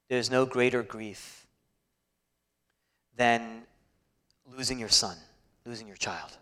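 low, softened pulpit voice with long pauses; hushed solemn preaching. Acted. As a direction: preach it gravely, letting each phrase settle. Preacher turns hushed and grave.

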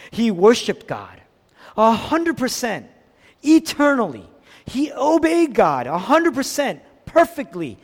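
high, exultant preaching with hard emphasis; impassioned preaching. Real, not acted. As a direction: build fervently, stretching the key words. Preaches at full force.

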